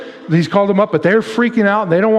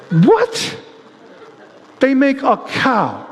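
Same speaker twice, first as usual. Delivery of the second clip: mock disbelief; 8 semitones higher, much slower than usual. Reacting to the cow idol.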